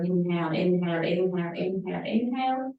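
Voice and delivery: quick repeated "inhale", lifting at the end; urging breath cue. Real, not acted. Yoga teacher urging students to keep breathing in, quick rising chant.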